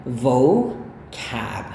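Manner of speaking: vocab said with stress falling from top to bottom; English pronunciation demo. Shown as a demonstration. Teacher models vocab for students. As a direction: demonstrate vocab as a careful English model word. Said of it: "kayak gitu".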